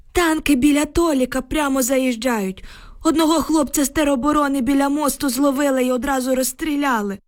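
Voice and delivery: put-on high-pitched old man's voice, upset; agitated old village man. A narrator's reading. Shaken neighbour reports to the women, agitated old man.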